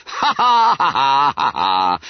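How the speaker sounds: loud mock laughter; laughing. Juniors laughing at Bunter's request. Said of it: "They all laughed".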